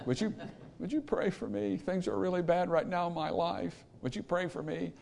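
soft, timid asking; meek plea. Retold or imitated. King Zedekiah meekly asks Jeremiah for prayer, quietly. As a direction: ask quietly like a man too proud to repent. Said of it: "that's how he said it".

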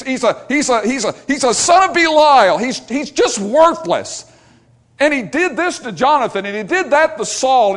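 hammed-up bitter rant; mock angry rant. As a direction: stammer out the accusations in a fury. Angry man's stammered list of reasons.

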